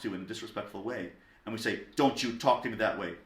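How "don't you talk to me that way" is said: sharp, louder parental reprimand; mock-angry scolding with more force. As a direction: act out an offended parent telling off a child. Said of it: "I'm showing anger".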